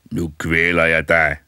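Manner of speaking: slow, loud menacing dead man; a corpse rising to strangle; threatening corpse. A narrator's reading. Dead man rises and threatens the boy, slow and loud. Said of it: "råbte".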